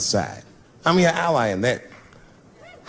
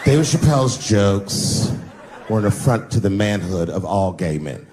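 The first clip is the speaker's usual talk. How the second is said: slow, low put-on blogger drawl; mocking made-up voice of a blogger; 7 semitones lower than usual. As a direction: stretch it out in a mocking invented voice. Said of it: "I'm just making this voice up".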